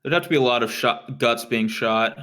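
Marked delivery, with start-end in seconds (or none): none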